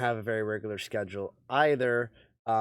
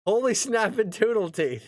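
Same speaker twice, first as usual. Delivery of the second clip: higher, lightly voiced mock of the caller's exclamation; imitating the caller; 8 semitones higher than usual. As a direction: echo the caller's startled outburst, a touch higher. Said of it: "That was what he said".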